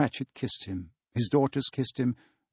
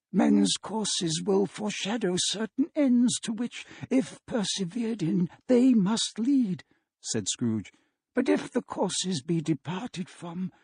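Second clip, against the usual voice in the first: put-on higher, hushed pleading old man; Scrooge voice; 7 semitones higher than usual. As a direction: beg the silent ghost for hope.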